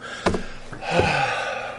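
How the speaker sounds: long, breathy, drawn-out sigh; manipulative sigh. Acted. Waiting for somebody to ask what's wrong, put-upon sigh. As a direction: sigh and wait to be asked. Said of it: "that's the kind of manipulation".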